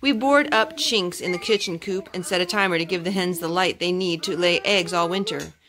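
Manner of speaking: ordinary delivery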